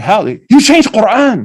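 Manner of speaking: high, loud, slow mock accusation; imitating an accuser's shocked demand; mock outrage. Mimicking outraged congregants' reaction.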